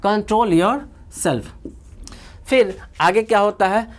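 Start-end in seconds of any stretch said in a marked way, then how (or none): 0.1-1.4 very slow, each word drawn out; slow drill. Shown as a demonstration. Teacher modelling a phrase for students, very slow. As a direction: model it slowly for repetition. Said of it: "क्या कहते हैं".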